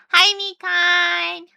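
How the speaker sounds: high, slow sing-song hello with the hi stressed; cutesy greeting. Acted. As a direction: coo it lightly.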